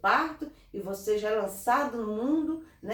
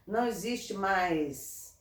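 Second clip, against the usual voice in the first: normal talking voice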